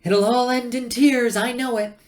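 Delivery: high shrill computer shouting after the crew; shouted nanny warning. A narrator's reading. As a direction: wail it after them like a fussing mother. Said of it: "shouted Eddie after them".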